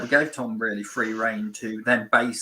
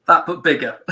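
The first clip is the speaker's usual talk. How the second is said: "bigger" said louder and higher; emphatic demand; 5 semitones higher, 8 dB louder than usual. Retold or imitated. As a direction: push the word "bigger" hard. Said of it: "most of the time was".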